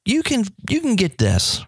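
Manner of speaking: ordinary talk, nothing marked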